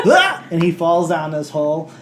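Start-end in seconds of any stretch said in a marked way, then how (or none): 0.1-0.5 loud high laughing shout; laughing yell. Real, not acted. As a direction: let out a laughing yelp.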